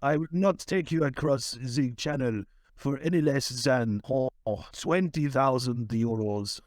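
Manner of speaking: thick put-on French accent; French boatman. French boatman naming his steep price to a fugitive, French accent. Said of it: "Mister French boatman".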